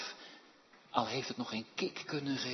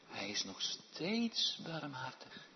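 ordinary talk

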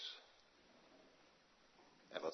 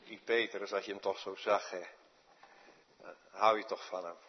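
usual speaking voice

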